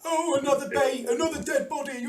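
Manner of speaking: high-pitched heckler; cry from the crowd. Acted. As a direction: jeer from the stands, pitched high. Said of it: "various cries from the crowd".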